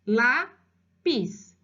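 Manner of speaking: slow LÁPIS split into lá-pis; syllable by syllable. Shown as a demonstration. Teacher demonstrating how he read it, syllable by syllable. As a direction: say LÁPIS in two separate beats. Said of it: "Ele leu exatamente silabado".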